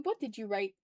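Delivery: normal talking voice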